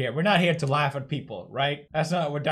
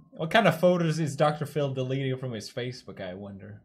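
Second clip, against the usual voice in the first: usual speaking voice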